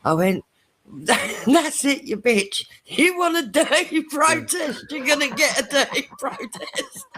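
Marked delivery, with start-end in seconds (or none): 1.5-7.0 high-pitched protest breaking into laughter; mock angry outburst. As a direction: retell a tirade, pitched way up and laughing. Acted outburst at nurse, laughing.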